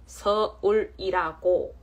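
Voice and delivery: 서울이라고 stretched very slowly and firmly; drawn-out emphasis. Real, not acted. Streamer correcting a viewer about her home, slow emphasis. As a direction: lean on every syllable of 서울이라고.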